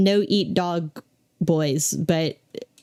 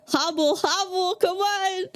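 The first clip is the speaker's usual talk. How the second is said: relieved laughter; 9 semitones higher than usual. Real, not acted. Laughing while urging the dog.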